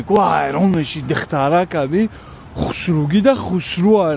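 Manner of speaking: usual speaking voice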